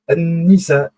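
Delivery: An-Nisa with the Al swallowed into a doubled n; teacher showing a solar letter. Shown as a demonstration. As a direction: merge the article into the n of An-Nisa. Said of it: "on n'a pas prononcé l'article Al".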